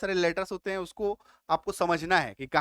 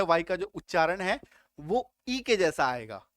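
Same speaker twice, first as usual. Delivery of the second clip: usual speaking voice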